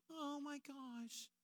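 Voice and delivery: high, soft, drawn-out "Oh my gosh"; gushing admirers. Retold or imitated. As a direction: coo like an impressed onlooker. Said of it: "And then people go".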